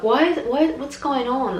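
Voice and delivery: freaking out. Retold or imitated. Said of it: "starting to freak out".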